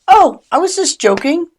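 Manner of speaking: high, loud mock innocence; exaggerated sarcastic backpedal; defensive brush-off. Shown as a demonstration. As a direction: retreat behind a joke, dismissively. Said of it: "the speaker can retreat behind".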